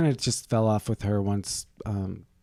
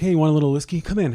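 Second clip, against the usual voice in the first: higher, louder, friendly beckoning; friend's invitation; 5 semitones higher, 6 dB louder, faster than usual. Retold or imitated. Friend offering whiskey, higher and beckoning. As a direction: call him in, upbeat and inviting. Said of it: "My friend's like".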